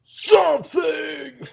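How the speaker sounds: very high, stretched-out shout; playful drawn-out holler. Pretend. Host supplying the tag team partner's name, playfully hollered. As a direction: holler it slowly like a hype call.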